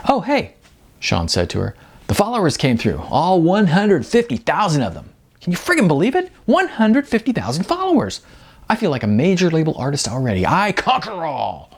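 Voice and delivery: a thrilled musician boasting to his friend; excited bragging Sean. Acted. Sean bragging about followers.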